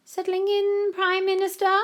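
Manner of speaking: very high, loud, syrupy greeting; mock-sweet. A narrator's reading. Violetta stalks towards the new Prime Minister, falsely sweet. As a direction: coo it with false friendliness.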